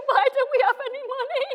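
high, loud, laughing mock wail of a child; imitating crying child. Mother imitating her daughter crying in worship, high and laughing. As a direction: wail it like a little girl upset, laughing through it. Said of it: "she started crying".

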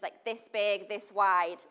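usual speaking voice

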